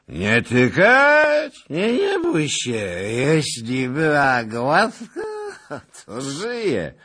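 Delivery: put-on very high, loud, slow drunken sneer; mock his pain like a leering drunk; drunken cruel mockery. A narrator's reading. Drunk nobleman cruelly sneers at lieutenant, high and slow.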